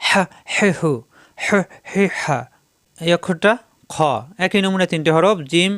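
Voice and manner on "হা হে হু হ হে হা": হা, হে, হু with an airy hiss from mid-throat; short হা, হে, হু, each with a breathy friction; hissy throat H. Shown as a demonstration. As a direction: squeeze the throat so হা, হে, হু come out rasping with breath. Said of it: "প্রত্যেকবার ফেস ফেস আওয়াজ হবে".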